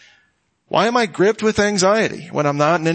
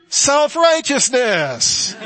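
Self-righteousness drawn out slow and high; dramatic reveal; 9 semitones higher, much slower than usual. Acted. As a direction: unveil Self-righteousness like a punchline. Dramatic reveal of last sin.

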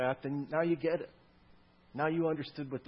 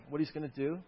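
ordinary delivery

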